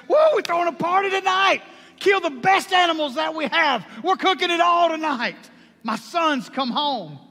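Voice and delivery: high, loud, fast cry of celebration, slowing at the end; ecstatic shout. Acted. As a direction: throw the party with everything you've got. Father announces the party.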